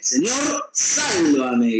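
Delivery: desperate plea from a sinking man; cry for help. Retold or imitated. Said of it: "gritó".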